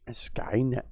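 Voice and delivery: breathy whispered name, spooky; ominous whisper. Acted. Streamer answering his own question, spooky whisper. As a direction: hiss it like a secret.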